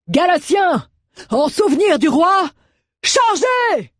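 high, loud rallying shout to his men; battle cry. A narrator's reading. Commander shouting his troops into the charge. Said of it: "et cria".

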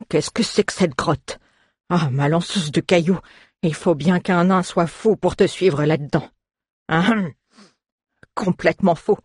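muttered dwarf complaint with a throat-clearing; grumbling dwarf. A narrator's reading. Dwarf complaining about following her into the cave, grumbling. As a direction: grumble to yourself in a put-on dwarf voice. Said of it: "grommela le nain dans sa barbe".

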